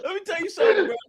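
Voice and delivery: high, teary laughing; crying with laughter; barely getting words out from laughing; laughing to tears. Real, not acted.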